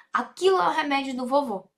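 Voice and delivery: Aquilo é o remédio do vovô rattled off fast; brisk everyday pace; quick repeat. Shown as a demonstration. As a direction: run through it quickly in one breath. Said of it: "translation said twice".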